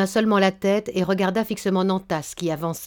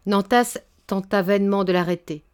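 ordinary delivery, nothing marked

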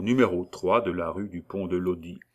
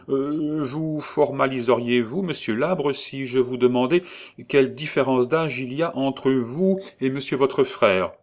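put-on a touch higher, polite elderly notary; a hint of a fussy notary, probing; lightly voiced notary; 6 semitones higher, 4 dB louder than usual. A narrator's reading. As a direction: ask with smooth professional courtesy. Notary questions Paul.

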